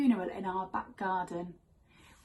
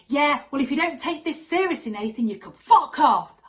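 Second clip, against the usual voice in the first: loud, high-pitched mock tantrum; little sister gone mad; 5 semitones higher, 7 dB louder than usual. Little sister scolding Nathan at the pet funeral, mad. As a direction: tear into him like a furious little girl. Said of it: "My little sister went mad".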